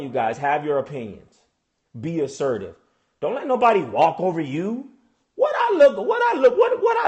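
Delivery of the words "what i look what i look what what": high-pitched, incredulous outburst; indignant disbelief. Acted. Parent about advice to daughters, indignant disbelief. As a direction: jump up high in mock outrage. Incredulous at being told otherwise.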